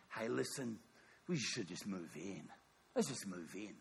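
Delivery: quiet, low-pitched suggestion; low confidential coaxing. Acted. Campers nudging each other to move into the city, confidential. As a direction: coax them softly, voice dropped.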